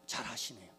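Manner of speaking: ordinary talk, nothing marked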